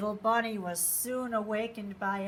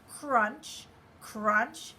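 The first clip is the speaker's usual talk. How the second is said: ordinary delivery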